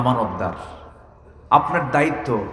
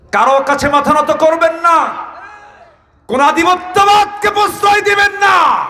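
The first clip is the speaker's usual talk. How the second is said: quoted salute building to a high, full shout; rally a nation, rising louder and higher; defiant rallying cry; 7 semitones higher, 5 dB louder than usual. Acted. Quoting a defiant message to Iran.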